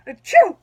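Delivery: sudden loud burp that breaks into the sentence; a burp escapes, high and loud. Real, not acted. Woman burping in the middle of her church testimony, loud burp. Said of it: "these burps that came on".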